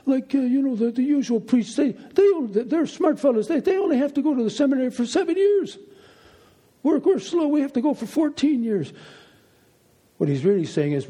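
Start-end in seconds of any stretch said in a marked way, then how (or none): 0.0-8.9 high-pitched imitation of an old Jesuit priest; Mister Donnelly. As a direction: play the elderly priest in a higher voice, explaining away. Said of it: "he was quick to say".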